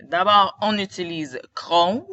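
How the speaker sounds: normal talking voice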